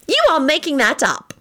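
high, scandalized accusation; incredulous girl's squeal; disbelieving screech. A narrator's reading. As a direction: protest in high-pitched disbelief. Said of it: "screeched disbelievingly".

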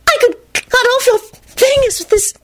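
very high, quivering fervour; unhinged high-pitched rapture; fervent, breathed. A narrator's reading. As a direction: breathe it like a deranged admirer. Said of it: "she breathed fervently".

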